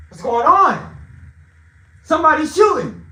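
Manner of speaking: loud, high cries of alarm; alarmed shouting. Retold or imitated. King reacts to a gunshot at the table, alarmed shouting. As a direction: shout it out as a sudden warning.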